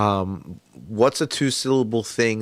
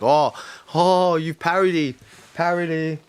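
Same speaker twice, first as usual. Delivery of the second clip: impressed listener; 6 semitones higher than usual. Retold or imitated. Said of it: "the more it's gonna be like".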